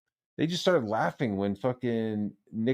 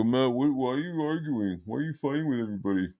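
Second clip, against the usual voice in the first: higher, softer mock appeal; imitated pleading caller; 3 semitones higher, 5 dB quieter than usual. Streamer mimicking a guest coming on to scold him, mock pleading. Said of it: "I'm gonna come on and go".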